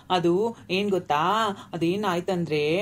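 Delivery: slow, stretched imitation of Bangalore speakers; drawn-out Bangalore talk. Friend showing how Bangalore people talk, slow drawl. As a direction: stretch each phrase out, mock city style. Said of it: "ಇಷ್ಟು ಉದ್ದರಾಗ ಹೇಳ್ತಾರ್ರಿ".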